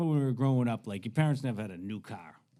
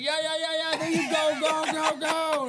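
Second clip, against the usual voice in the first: loud, very high rapid-fire urging; imitated wild cheering; 7 dB louder than usual. Hippie to teen in intersection, imitated frantic cheering. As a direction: holler them onward, voice way up.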